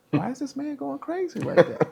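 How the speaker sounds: very high-pitched, comic disbelief; bewildered. Retold or imitated. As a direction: squeak out a baffled inner question. His bewildered reaction, retold.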